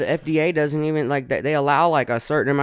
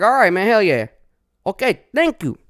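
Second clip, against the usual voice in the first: eager reply; 8 semitones higher, 7 dB louder than usual. Retold or imitated. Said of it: "I'm like".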